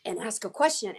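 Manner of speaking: ordinary talk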